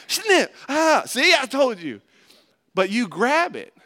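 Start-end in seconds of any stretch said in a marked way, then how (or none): 0.1-2.0 much higher, louder playful snip then cry; mock snip and yelp. Preacher pretends to snip a listener's finger, playful high yelp.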